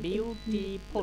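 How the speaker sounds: "Beautiful" very slowly as বিউ-টি-ফুল; three separate stretched syllables in "beautiful"; teacher slowly sounding out "beautiful". Shown as a demonstration. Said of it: "তিনটা syllable আছে".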